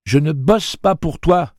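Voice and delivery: high, loud, slow defiance; loud, deliberate teenage defiance; defiant. Acted. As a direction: spit it at your father, slow and high. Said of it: "dit Jake".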